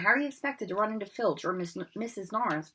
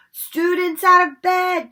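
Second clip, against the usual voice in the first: slow, very loud and high; bellowed; 11 semitones higher, 13 dB louder, slower than usual. Acted. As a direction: bellow the alarm. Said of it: "Peeves bellowed".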